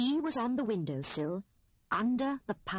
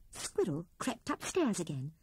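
plain reading voice

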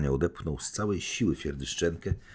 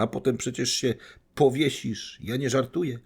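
much higher, taunting cry; shouting on; 9 semitones higher than usual. Acted. Anastazja taunting Gania as the packet burns, high cry. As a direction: keep the taunt ringing high. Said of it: "krzyczała Anastazja Filipowna".

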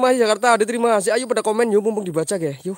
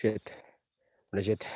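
lower, softer curse under the breath; muttered curse; 10 semitones lower, 10 dB quieter than usual. Real, not acted. Gamer cursing to himself over the game, muttered curse. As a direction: swear quietly to yourself.

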